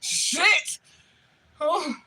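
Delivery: high, loud, half sobbing with laughter; helpless mortified laughter, near tears; laugh-crying. Real, not acted. Laugh-crying over the mortifying memory.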